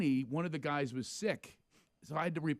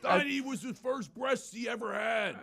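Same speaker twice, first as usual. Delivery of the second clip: heckling shout; 7 semitones higher than usual. Retold or imitated. Friends heckling from front row.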